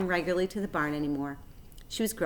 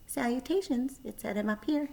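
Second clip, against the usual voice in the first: small voice; 6 semitones higher than usual. Acted. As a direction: greet him gently from above. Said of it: "he heard a small voice".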